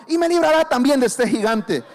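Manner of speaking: loud, ringing finish; triumphant declaration. Retold or imitated. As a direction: cap the boast with certainty. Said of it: "Le dice".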